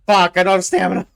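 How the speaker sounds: loud, fast panicked outburst. Real, not acted. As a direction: blurt it fast in real panic. Chased without stamina.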